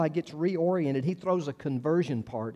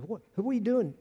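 higher, mock-scandalized church elder; indignant authorities; 5 semitones higher, 3 dB quieter than usual. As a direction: demand it like a shocked religious friend.

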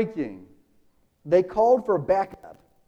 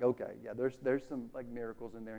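low, softer shrugging mumble; dismissive aside; 7 semitones lower, 11 dB quieter than usual. Retold or imitated. Historian shrugging off the miracles, dismissively quiet. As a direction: wave the miracles off as no big deal. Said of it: "He's kinda discounting those".

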